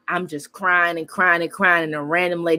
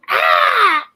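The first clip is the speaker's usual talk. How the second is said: mock wail; 11 semitones higher, 4 dB louder than usual. Reenacting bathroom crying.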